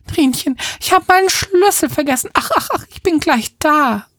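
high-pitched fretful lament; frantic wailing. Acted. Locked-out woman promising her cat she's coming, wailing. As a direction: cry out high, in a flustered wail. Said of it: "jammert sie und rüttelt weiter".

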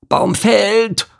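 high, drawn-out warning call. Acted. Beaver warns as the tree comes down, calling out. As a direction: call out like a lumberjack. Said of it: "hörte man den Ruf".

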